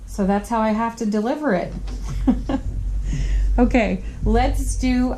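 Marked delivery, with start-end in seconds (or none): 1.8-3.5 giggle. Real, not acted. Laughs after explaining her delivery.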